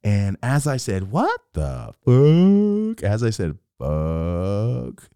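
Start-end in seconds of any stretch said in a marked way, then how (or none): 1.1-3.0 high, loud, hugely stretched words; drawn-out slow-mo cry; slow-motion shock. Acted. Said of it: "I can hear it in slow-mo". 3.8-5.1 one long drawn-out word; drawn-out slow-mo delivery; slow-motion. Acted. Slow-motion replay of his reaction.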